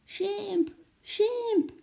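held-back high shriek; mock yell. A narrator's reading. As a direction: squeal the name as a child would. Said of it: "Lotje gilde zo hard ze kon".

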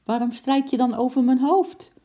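high, loud challenge; indignant bickering wife; indignant question. A narrator's reading. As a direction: demand an answer, voice climbing. Acted quarrel, wife challenges husband.

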